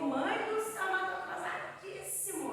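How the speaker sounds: imitando um filho aflito, em tom bem agudo; reclamação aguda de menino para a mãe; aflição imitada. Retold or imitated. Filho aflito com o atraso, reencenado. (filho exasperado, imitado em voz aguda)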